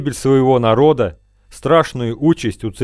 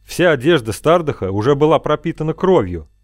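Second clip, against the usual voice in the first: usual speaking voice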